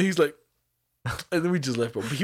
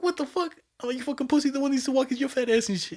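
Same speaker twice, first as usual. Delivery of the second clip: much higher, squeaky outraged yelling; high excited retort; 11 semitones higher than usual. Retold or imitated. Insulting the seller again, high mock outrage.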